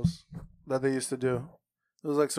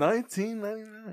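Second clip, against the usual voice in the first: infomercial; 8 semitones higher than usual. Retold or imitated. Echoing infomercial pitch.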